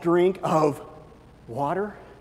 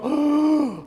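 a hammed-up gasp at a shiny car; mock gasp of delight; 10 semitones higher, 5 dB louder than usual. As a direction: gasp like a dazzled buyer. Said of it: "by the car dealership and we go".

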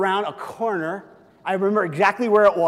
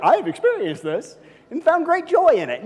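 mock smug mom; 9 semitones higher, 4 dB louder than usual. Mocking moms' attitude.

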